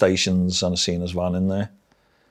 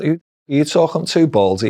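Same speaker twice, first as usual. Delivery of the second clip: cheeky challenge; 8 semitones higher than usual. Retold or imitated. Cheeky retort to new governor.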